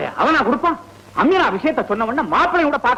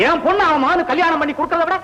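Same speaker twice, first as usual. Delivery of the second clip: higher and higher in rage; furious threat; 7 semitones higher than usual. Acted. Father swears he would kill the rival first, in rage. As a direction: spit out the threat in fury.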